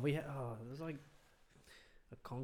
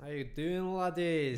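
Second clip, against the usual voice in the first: put-on Scottish greeting, getting louder; Scottish accent; 3 semitones higher, 6 dB louder, slower than usual. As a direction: imitate a Scottish girl saying hello. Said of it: "a Scottish accent".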